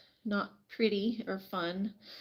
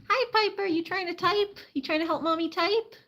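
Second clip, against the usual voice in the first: talking to pet; 9 semitones higher than usual. Real, not acted. Greeting pet bird on arm.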